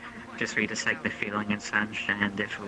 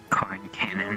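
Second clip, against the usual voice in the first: whispering; slower than usual. Acted. GM whispers aside.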